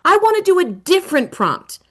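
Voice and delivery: a hint of a surprised student; kids' reaction. Students reacting to the story, lightly voiced. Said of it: "kids will start to see that".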